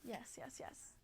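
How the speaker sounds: breathy whispered yeses; soft whispered agreement. Real, not acted. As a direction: murmur it under your breath. Quiet aside to chat.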